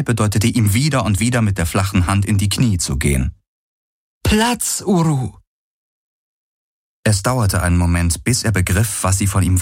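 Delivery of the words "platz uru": high, falling animal command; insistent command. A narrator's reading. Kirai signals her mount to kneel, insistent.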